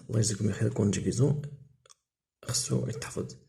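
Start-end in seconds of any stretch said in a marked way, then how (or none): none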